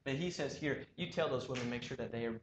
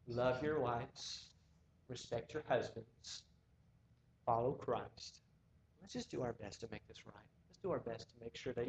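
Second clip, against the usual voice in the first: tender; 3 semitones lower, 6 dB quieter than usual. Real, not acted. Slow soft exhortation.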